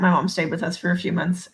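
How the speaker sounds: normal talking voice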